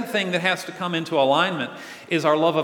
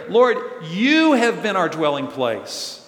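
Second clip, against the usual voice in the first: slow, loud phrase with "you" drawn out; stress on YOU; 5 dB louder, slower than usual. Acted. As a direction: punch and stretch "you" as the one addressed. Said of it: "why the emphasis on the word".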